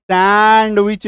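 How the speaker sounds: सैंडविच very drawn out, सैं—ड-विच; wrong pronunciation. Shown as a demonstration. Teacher exaggerates what to avoid, wrong pronunciation. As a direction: stretch the first syllable and sound the D. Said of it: "नहीं बोलेंगे".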